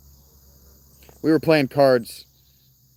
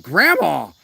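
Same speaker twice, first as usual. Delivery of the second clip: scandalised yelp, louder; mock outrage at grandma; shocked shout; 5 semitones higher, 6 dB louder than usual. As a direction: call her name out in disbelief. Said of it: "And I'm just like".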